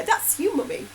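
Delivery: put-on high little child voice. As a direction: voice a proud toddler.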